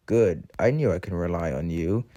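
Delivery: a hint of smarmy false praise; Diesel. Engine flattering his victim, lightly oily. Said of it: "in his oily voice".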